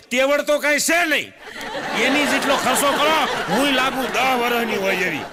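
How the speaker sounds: angry wife. Retold or imitated. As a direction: rant at husband, ending laughing. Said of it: "મને કે". (પત્ની પતિને ઠપકો આપે, ગુસ્સાથી)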